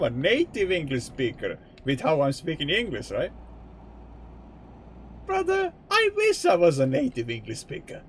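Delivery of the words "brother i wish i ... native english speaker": high exclamation. Real, not acted. Exclaims about his English.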